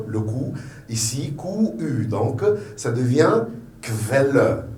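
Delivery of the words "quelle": slow "Quelle" begun K-V, KVEL-le. Shown as a demonstration. Teacher explaining German Q-U, slow KV sound. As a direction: say "Quelle" with a V after the K. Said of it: "ça devient un K-V".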